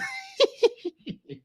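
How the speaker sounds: laughing so hard it squeaks; a wheezing, high-pitched cackle; helpless squealing laughter; high crying laugh. Real, not acted. Laughing at own struggle.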